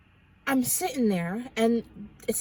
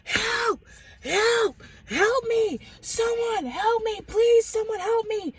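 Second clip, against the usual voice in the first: high, loud panicked screams for help; screaming for help; 13 semitones higher, 3 dB louder, slower than usual. Retold or imitated. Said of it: "a young man screaming bloody murder".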